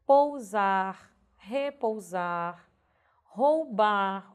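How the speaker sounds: "Pousar, repousar, roubar" lentos, com OU pleno; pronúncia correta. Shown as a demonstration. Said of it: "Se você pronunciar corretamente". ("Pousar, repousar, roubar" silabados e enfáticos)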